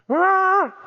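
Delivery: sudden very high, awed "Ah"; amazed gasp. Retold or imitated. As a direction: imitate someone stunned by the result. People marvelling at the result.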